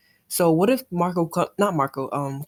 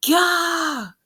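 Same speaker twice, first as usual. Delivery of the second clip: startled gah; 10 semitones higher than usual. Real, not acted. Reacting to the panel.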